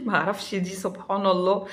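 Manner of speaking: laughing. Real, not acted. Laughs at her own fussiness.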